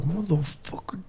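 unvoiced, hushed swear under breath; whispered curse. A dad at his kid, whispered curse. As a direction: hiss it quietly through the teeth. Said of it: "I'm like".

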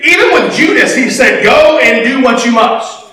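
high-pitched, emphatic sermon delivery; preaching. Real, not acted. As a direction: drive the point home to the congregation. Preaches with raised, driving voice.

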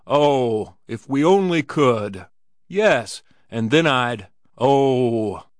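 loud, impassioned longing; fervent wail, drawing out each "oh"; yearning, fanatic cry. A narrator's reading. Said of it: "they raised a yearning, fanatic cry".

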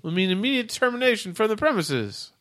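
high stiff robot announcement; robot warning. Acted. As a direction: recite the rule like a machine.

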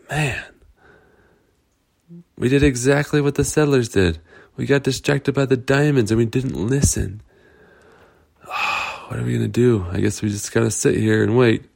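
put-on mild worried kids; lightly voiced girls. Trapped girls talk in cave.

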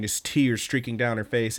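ordinary delivery

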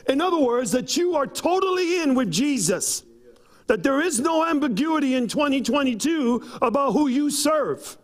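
high, rising exhortation to a shout; preaching build. Acted. Pastor exhorts the congregation, building to a high shout. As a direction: preach it up to the climax.

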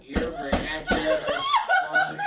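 loud, laughing, cracking up; laughing shout. Real, not acted. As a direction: yell it while cracking up.

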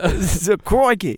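drawn-out Aussie astonishment; hammed-up Australian exclamation; Australian accent. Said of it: "He does Australian".

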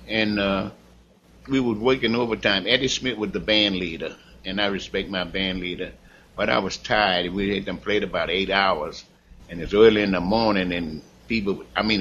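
ordinary talk

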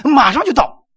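slightly put-on voice, pitched high, of the latecomer; late friend on phone. Busy brother answers another call from waiting friends, lightly mocked reassurance. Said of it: "再打电话".

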